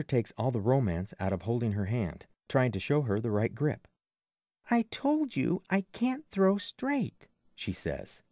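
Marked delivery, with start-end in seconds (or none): 4.7-7.3 much higher, slow and drawn-out; high girl's voice. A narrator's reading. Pitcher to the boy coaching her, high and protesting. Girl snaps back at coaching narrator.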